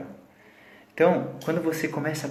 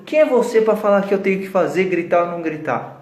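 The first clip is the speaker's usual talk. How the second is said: put-on indignant old lady; 5 semitones higher, 5 dB louder than usual. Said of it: "parou e falou".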